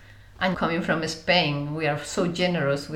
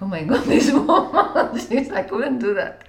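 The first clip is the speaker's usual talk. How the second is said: laughing disbelief; 5 semitones higher, 6 dB louder than usual. Real, not acted. Amused disbelief.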